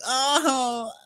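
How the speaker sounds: high, whiny mock victim; mock wounded whine. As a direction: whine it like the hurt one. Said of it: "Heather's whole like".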